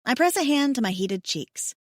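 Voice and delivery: ordinary delivery, nothing marked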